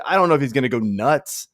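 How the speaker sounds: ordinary talk, nothing marked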